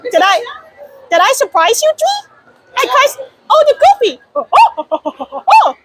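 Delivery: usual speaking voice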